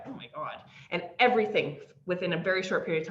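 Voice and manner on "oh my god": awed hush. Retold or imitated. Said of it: "He's like".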